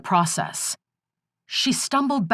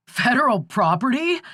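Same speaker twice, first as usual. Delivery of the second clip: outburst; 6 semitones higher than usual. A narrator's reading. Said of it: "Skip burst out".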